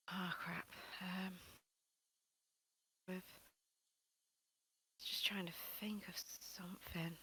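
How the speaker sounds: whispered to yourself; whispering. Real, not acted. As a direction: whisper it while thinking. Muttering to self while thinking.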